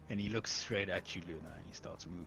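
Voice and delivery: usual speaking voice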